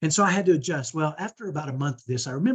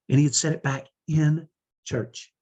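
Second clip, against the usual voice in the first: ordinary talk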